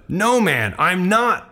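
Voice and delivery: high, emphatic denial; sharp retort. Acted. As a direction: fire back a firm denial, pitch up. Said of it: "Peter retorted".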